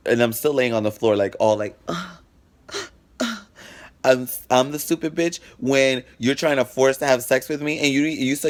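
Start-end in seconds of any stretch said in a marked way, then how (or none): 1.9-3.4 high thin groaning "uh, uh" played back softly; mock pained groans. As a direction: groan like you're lying hurt on the ground. Lying hurt on the floor.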